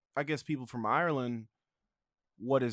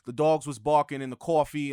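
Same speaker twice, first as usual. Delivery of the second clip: mock Brooklyn accent, a bit louder; 3 semitones higher, 6 dB louder than usual. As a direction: lay on a Brooklyn Italian accent. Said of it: "we say Brooklyn accent".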